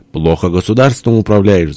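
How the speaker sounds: ordinary delivery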